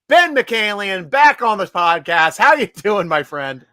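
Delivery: loud, drawn-out show host welcome; announcer intro. Acted. Host welcoming guest back to the podcast, loud announcer voice. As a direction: boom it out like a radio host.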